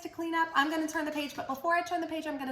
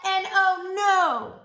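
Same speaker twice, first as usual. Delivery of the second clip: mock dismay; 6 semitones higher, 7 dB louder, much slower than usual. Drawn-out surprise.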